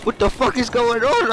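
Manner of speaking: high-pitched stoned panic; freaking out. Retold or imitated.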